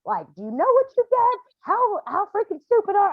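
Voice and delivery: high, loud tongue-lashing at her son; a mother yelling at her dead son in the yard; angry scolding. Retold or imitated. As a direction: tear into him, furious and shrill. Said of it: "he got tongue lashings".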